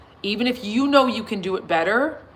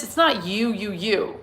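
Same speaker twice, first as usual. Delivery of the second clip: normal talking voice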